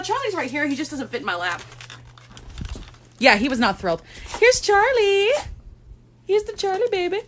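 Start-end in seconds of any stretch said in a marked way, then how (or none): 4.4-7.2 high cooing pet talk. Real, not acted. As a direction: show off the cat in a cooing voice. Baby talk to second cat.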